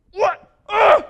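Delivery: high, loud startled cry; mock startled yelp. Driver being cut off on the road, with a mock startled yelp. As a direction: jump out of your skin, hammed-up. Acted driver hit by rock.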